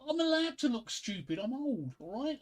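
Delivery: high-pitched mock indignation; indignant protest. Husband told he looks stupid by his missus, indignant protest.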